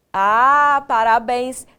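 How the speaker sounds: ordinary talk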